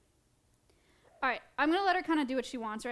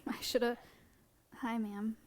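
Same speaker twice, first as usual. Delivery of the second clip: usual speaking voice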